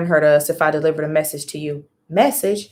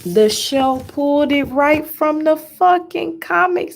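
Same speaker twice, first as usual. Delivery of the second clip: much higher, slow and drawn out; slow, high, shocked emphasis; slow shocked disbelief; 9 semitones higher, slower than usual. Real, not acted. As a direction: stretch every word in shock. Stunned reaction.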